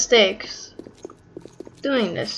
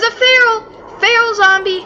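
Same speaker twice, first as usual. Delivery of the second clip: high, tense alarm at a zombie; sudden alarm, voice jumps high; 11 semitones higher, 4 dB louder than usual. Real, not acted. Spotting a feral zombie.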